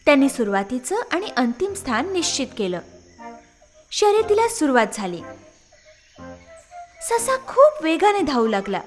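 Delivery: ordinary delivery, nothing marked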